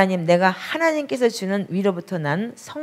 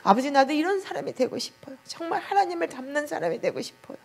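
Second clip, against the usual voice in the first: high, hushed pleading prayer, retold as if weeping; 7 semitones higher than usual. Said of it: "두 시간을 통곡을 하면서 눈물로". (높고 조용하게 울먹이는 회개 기도)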